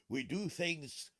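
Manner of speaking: slow, drawn-out pirate speechifying; rally the crew like a cartoon pirate; mock pirate captain.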